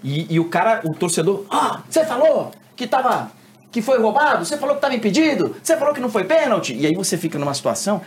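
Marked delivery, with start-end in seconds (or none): 1.5-6.7 high, fast accusing fan; mock angry fan. Angry fan confronting the reporter, hammed-up high. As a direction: needle the reporter like a heckler.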